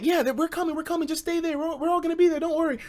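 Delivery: high mock girl voice; a light high-pitched imitation; girls' voice. Girls reassuring his friends by phone, high mock voice.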